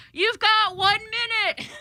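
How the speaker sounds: high, slow, stretched-out yell from the guard; screamed warning. Retold or imitated. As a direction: yell the deadline across the store. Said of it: "he screams after me".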